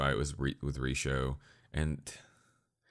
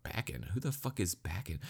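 puzzled aside; 8 dB quieter than usual. Retold or imitated. Acts out his own puzzled reaction.

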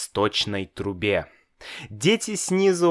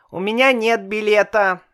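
put-on scared boy; 10 semitones higher, 4 dB louder, faster than usual. A narrator's reading.

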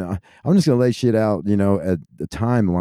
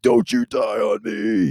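high, slow, pleading cry; desperate plea; 10 semitones higher, slower than usual. Retold or imitated. Hunter pleading with the deer he just shot, high and slow. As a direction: beg the dying deer to hang on, stretched out high.

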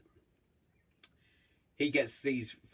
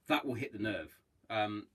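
ordinary talk

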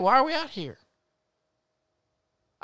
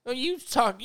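ordinary talk

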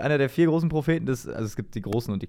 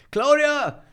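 higher, louder call to a colleague; re-enacted calling out; 10 semitones higher, 6 dB louder than usual. Recalls asking colleague Claudia about the Bible story, calling out. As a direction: call over to her, then light up at the answer.

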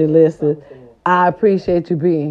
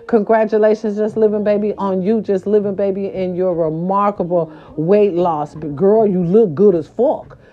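ordinary delivery

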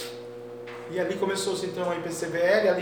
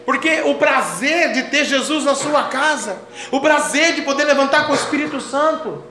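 raised, high-pitched sermon cadence; full-force preaching; 7 semitones higher, 8 dB louder than usual. Real, not acted. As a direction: proclaim it fervently to the congregation. Prega com força total. (pregação fervorosa com voz elevada)

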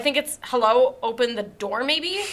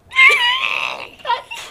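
high shrieking burst of laughter. Real, not acted. Reacting to chapter end.

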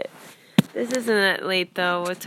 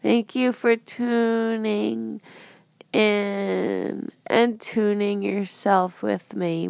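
slow thanks; slower than usual. Real, not acted.

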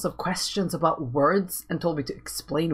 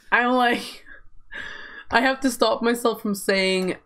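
ordinary delivery

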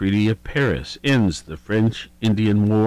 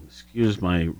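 ordinary talk, nothing marked

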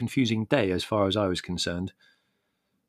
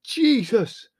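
high, loud yell of pain; roar; 12 semitones higher, 7 dB louder than usual. A narrator's reading. Leslie just kicked on the shin, roaring. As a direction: roar out the oath. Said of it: "roared Leslie".

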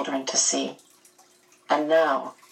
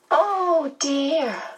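high, fluting medium voice; a dismayed little murmur pitched way up; high-voiced medium's "oh dear" moment; 9 semitones higher than usual. A narrator's reading. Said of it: "the medium murmured".

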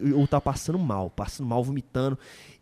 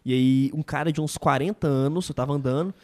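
usual speaking voice

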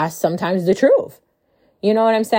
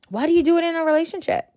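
higher and faster, pressing the question again; exasperated repeat; 9 semitones higher, much faster than usual. Real, not acted. Repeating the question to listeners, higher and exasperated. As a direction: fire it back at them, incredulous.

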